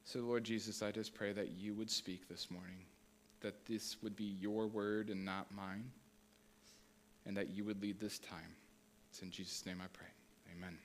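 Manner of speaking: soft, hushed prayer to open the service; quiet prayer. Acted. Pastor praying softly before the congregation, hushed. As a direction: pray gently and humbly.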